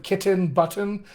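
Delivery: "kitten" and "button" with a fully released, aspirated t; crisp plosive t before a clear vowel in "kitten", "button". Shown as a demonstration. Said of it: "not use that plosive".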